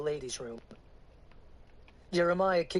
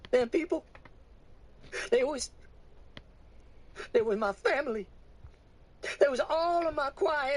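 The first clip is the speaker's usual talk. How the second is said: grieving woman; 11 semitones higher than usual. Acted. Woman confessing to the preacher, high grieving voice. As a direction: confess through grief.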